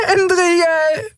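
very high, plaintive whimper; small creature's high crying voice; tearful wail. A narrator's reading. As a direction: wail the name through tears. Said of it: "klagte sie unter Tränen".

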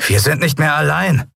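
high servant voice raising the alarm; sudden call. A narrator's reading. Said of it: "rief Telbo plötzlich".

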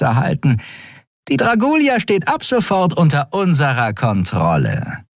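high-pitched female villain; gloating traitor Shiar. A narrator's reading. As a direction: announce the takeover with smug relish.

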